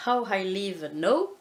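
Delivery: "How I Live Now" said slowly in a clumsy French accent; French-accented English title. Shown as a demonstration. Apologising to viewers for her bad English accent on "How I Live Now". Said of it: "excusez-moi pour l'accent pourri".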